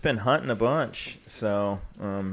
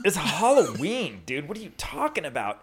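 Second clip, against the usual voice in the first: put-on confused kids; 6 semitones higher than usual. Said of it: "the little kids would be all".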